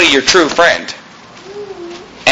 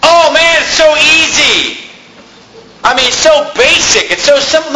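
mock bored student; 10 semitones higher than usual. Preacher mocking a student bored by an easy class, high whiny voice. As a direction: sneer at how easy it is.

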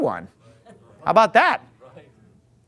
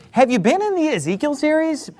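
normal talking voice

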